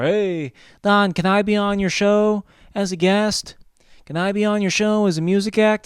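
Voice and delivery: high, loud put-on pleading voice; mock eager fans. Fans lined up asking to be on the show, mock eager. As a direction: beg in a high, pushy fan voice.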